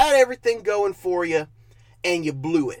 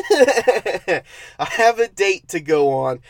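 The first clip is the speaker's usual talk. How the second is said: slow, laughing disbelief at the line; laughing repeat; 4 dB louder, slower than usual. Real, not acted. Reviewer laughing at the characters' excuse, slow amused disbelief. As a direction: repeat it through a laugh, can't believe it. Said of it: "laughs repeat".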